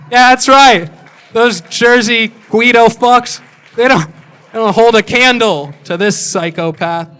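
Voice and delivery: loud, high mock bragging; mock boasting. Comic brags to the audience about out-crazying the Jersey Shore cast, loud mock boast. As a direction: crow it with hammed-up pride.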